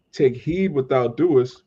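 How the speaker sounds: normal talking voice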